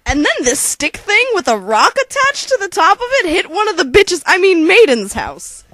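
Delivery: fast, loud breathless telling; excited rush. Acted. Narrator rushing the story, excited. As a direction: race through it, worked up.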